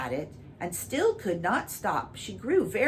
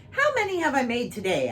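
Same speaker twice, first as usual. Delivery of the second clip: raised, louder kingly question; the king asks proudly, raised and loud; pompous king; 7 semitones higher, 5 dB louder, faster than usual. Acted. King asking his secretary, pompous and raised.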